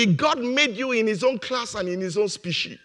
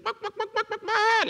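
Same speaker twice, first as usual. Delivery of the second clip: much higher mock quack quack; imitating quacking. Preacher imitates the eaglet's chicken talk, high mock quacking. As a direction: squeak out the quacks like a barnyard bird. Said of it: "talked like a chicken, did".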